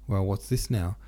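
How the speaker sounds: plain reading voice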